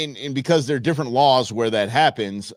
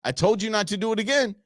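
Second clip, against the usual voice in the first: raised, mock-stern warning; scolding guard; 7 semitones higher than usual.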